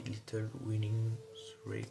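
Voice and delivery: slow, clumsy English accent from a French speaker. Shown as a demonstration. Vlogger reads the Hogwarts letter address aloud, awkward English accent. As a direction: read the address in a self-conscious English accent. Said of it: "désolé pour mon accent".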